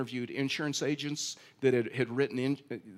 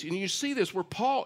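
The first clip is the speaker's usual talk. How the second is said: ordinary delivery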